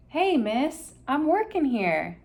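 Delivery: a tiny busy bee hollers up; tiny voice shouting. A narrator's reading. Honeybee shouts at Opal to stop picking, small and loud. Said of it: "a tiny voice shouted".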